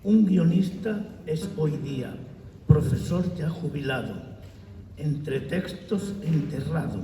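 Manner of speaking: loud, measured declaiming of verse; poem recitation. Acted. Poet reads his décima in honour of his friend Carlos, loud declamation. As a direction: declaim each line out to the room, pausing between verses.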